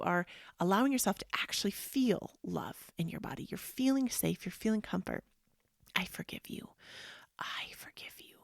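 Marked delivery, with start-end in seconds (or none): 5.9-8.4 very soft, hushed reassurance fading toward a whisper; tender self-forgiveness. Shown as a demonstration. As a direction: soothe yourself gently, almost whispering. Models soothing self-forgiveness practice.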